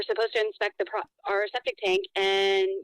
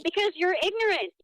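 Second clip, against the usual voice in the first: angry; 7 semitones higher than usual. Real, not acted. Angry customer snapping back.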